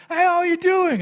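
very high, loud hearty greeting; overdone cheerful pastor hello; imitating pastor Gary. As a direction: greet him like a best buddy. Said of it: "Dus hij ging gewoon".

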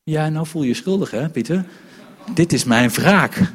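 playful mock gloating at a friend; mock revenge.

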